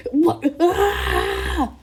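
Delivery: high, loud cry breaking into a scream; acted scream of alarm; startled scream. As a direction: yelp in shock, then scream. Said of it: "he's like".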